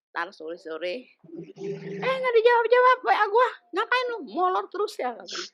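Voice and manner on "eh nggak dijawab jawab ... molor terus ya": high, mock nagging voice; mimicking scolding friend. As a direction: tease like an annoyed friend, pitched high.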